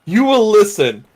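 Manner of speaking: loud, stern mock lecture; hammed-up commanding telling-off, pitch rising as it goes; scolding Force ghost. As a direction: lay down the law to a wayward grandson. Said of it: "have him scold".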